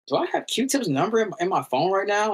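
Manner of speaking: high astonished wonder; stunned disbelief; amazed. Retold or imitated. Amazed inner thought.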